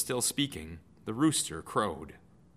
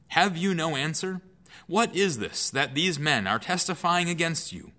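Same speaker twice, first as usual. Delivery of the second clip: demanding; 8 semitones higher, 4 dB louder than usual. Acted. High priest demands answer.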